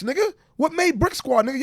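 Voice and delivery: usual speaking voice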